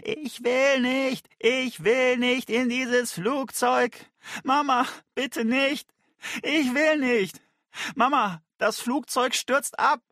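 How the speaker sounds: put-on high, slow, wailing child's scream. A narrator's reading. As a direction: wail like a stubborn kid refusing to board. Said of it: "gegen den Boden stemmte und schrie". (Kind schreit hoch und verzweifelt)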